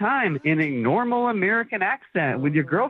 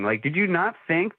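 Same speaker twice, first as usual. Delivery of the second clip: normal talking voice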